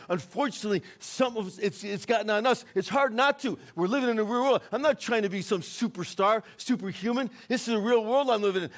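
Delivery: high, driving pulpit voice; fervent sermon at full force; impassioned preaching. Real, not acted. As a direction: preach it with urgent, unrelenting conviction. Preaches at full force about fear.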